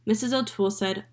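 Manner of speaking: usual speaking voice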